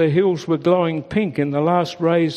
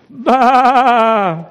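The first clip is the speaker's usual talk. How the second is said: high, loud bleat calling out; 7 semitones higher, 8 dB louder than usual. A narrator's reading. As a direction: call out to the far voice. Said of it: "called Daisy".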